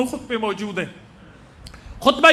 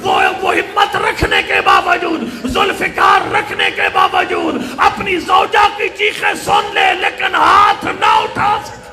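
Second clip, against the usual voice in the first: slow, raised, grief-charged preaching; impassioned lament preaching; 5 semitones higher, 4 dB louder, slower than usual. Real, not acted. Preacher to grieving congregation, full-force lament. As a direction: cry out the tragedy to the mourners.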